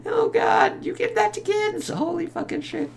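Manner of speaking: very high, soft squeal of disbelief; hushed falsetto mock horror; mock appalled reaction. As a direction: squeak it as if horrified. Acts appalled reaction to toy flamethrower.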